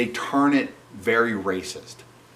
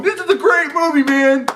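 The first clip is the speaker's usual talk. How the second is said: much higher, louder mock-gushing critic voice; 6 dB louder than usual. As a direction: parody a fawning critic. Mocking imagined critics praising it.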